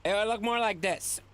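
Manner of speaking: high-pitched, exasperated protest; strained high indignation, drawing out the explanation; angry voice. Acted. Streamer insisting to chat he doesn't blend into his green screen, exasperated. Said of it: "I like your angry voice".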